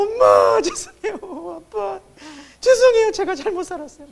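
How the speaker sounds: high-pitched mock crying, with bursts of loud wailing; imitated tearful wailing. Teenagers climbing out of coffins crying to their parents, imitated tearful wailing. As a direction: wail it out, imitating a sobbing teenager. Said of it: "울면서 나와가지고".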